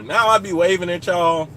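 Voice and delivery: usual speaking voice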